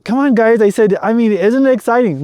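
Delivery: hyped-up daddy voice trying to win them over; coaxing excitement. Retold or imitated. Dad pitching excitement to kids.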